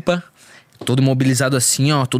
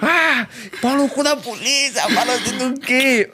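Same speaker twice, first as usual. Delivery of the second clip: high, slow slurred cursing; slurred high mock rant at the police; mock drugged rant; 10 semitones higher, much slower than usual. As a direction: ham up a wasted guy swearing at cops. Imitating himself cursing police, high-pitched.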